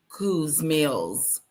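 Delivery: normal talking voice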